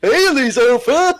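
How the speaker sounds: high, loud, giggly creep; creepy predator voice. Acted. Trying the creep voice in French, high and giggly. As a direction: call out slowly in a high creep voice. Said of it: "prendre cette voix-là, mais en parlant en français".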